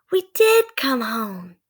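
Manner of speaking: put-on high, slow girl's relief; relieved girl. Annie realizing they are home, relieved and slow. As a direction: savor being home.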